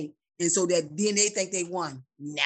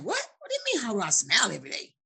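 fast, mildly incredulous comeback; taken aback; faster than usual. Retold or imitated. Mother answering people who question her smile, mildly incredulous. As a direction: throw the question back in disbelief.

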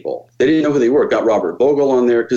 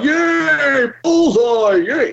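much higher, hammed-up flat celebration; mock the show's dull bullseye payoff; sarcastic mock cheer; much slower than usual. Mocking the show's repetitive format, hammed-up high cheer. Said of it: "it's just all one shot".

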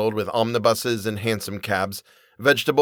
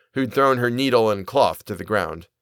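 plain reading voice

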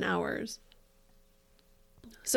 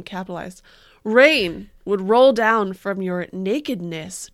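mock dramatic poetry reading; 8 dB louder than usual. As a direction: ham up the tragic verse.

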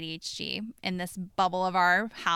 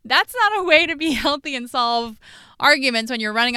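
laughing; 5 semitones higher, 9 dB louder than usual. Real, not acted.

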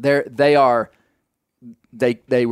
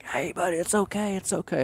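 put-on higher, much softer hush to soothe a scared toddler; soft hushed reassurance; 7 semitones higher, 9 dB quieter than usual. Dad up a tree soothing his crying toddler below, hushed reassurance. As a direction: hush it gently, like calming a crying child from up a tree. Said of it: "I'm whispering".

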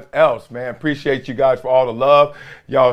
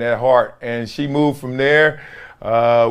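usual speaking voice

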